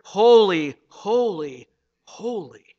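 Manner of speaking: first holy loud, then fading; emphatic holy. Shown as a demonstration. Preacher stressing God's holiness, emphatic. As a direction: build the weight into each holy. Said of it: "God isn't just holy".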